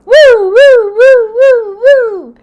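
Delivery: high, loud wailing siren whoops; car alarm imitation. Telling viewers the alarm returned, loud high siren wail. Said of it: "And what do I hear?".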